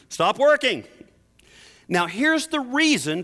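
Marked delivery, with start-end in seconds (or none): 0.1-0.8 commanding call. Pretend. Preacher acting out the command.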